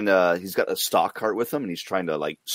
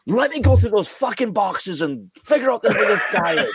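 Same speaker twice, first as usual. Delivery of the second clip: high, louder, hammed-up rant at the clerk; retold angry customer; 12 semitones higher, 4 dB louder than usual. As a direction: imitate an enraged customer shouting, half laughing. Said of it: "losing his fucking mind".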